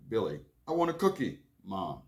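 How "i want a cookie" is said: put-on higher, louder pleading child; whiny kid voice. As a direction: beg like a little boy wanting a cookie. Child Billy begs mom.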